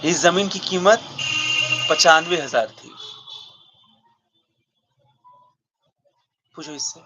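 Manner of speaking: halting dramatic monologue, heavy pauses between outbursts; lightly voiced stage character listing a life's sacrifices; aggrieved Uncle Vanya. Character telling the injustice they have experienced, aggrieved.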